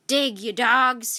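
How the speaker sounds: slow, loud pirate bellow; captain bellowing at his crew, slowly; shouted. A narrator's reading. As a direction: drag out each word as a loud order. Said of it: "he shouted".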